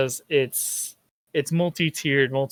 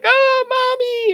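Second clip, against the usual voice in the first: shrieking much higher and louder in mock terror; hammed-up panicked cry; terrified scream; 9 dB louder than usual. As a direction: wail for mommy like a dying crewman. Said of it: "and they're like".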